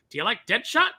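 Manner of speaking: high, eager sales pitch. Retold or imitated. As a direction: pitch it brightly. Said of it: "they'd be like".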